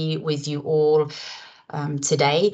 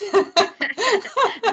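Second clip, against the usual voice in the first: bright real laugh on being introduced; short laugh. Real, not acted. Co-host introduces her, she laughs brightly.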